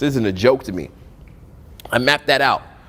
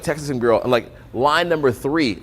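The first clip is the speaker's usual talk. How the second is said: ordinary talk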